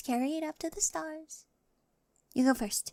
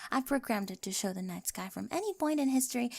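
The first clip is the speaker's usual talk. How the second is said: normal talking voice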